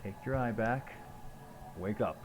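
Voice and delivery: ordinary talk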